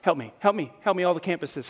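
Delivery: ordinary delivery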